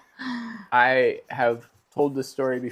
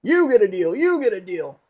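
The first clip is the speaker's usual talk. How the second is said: high, loud hammed-up announcer; playful game-show style shout; mock giveaway host; 9 semitones higher, 5 dB louder than usual. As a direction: hand out prizes in an excited high holler.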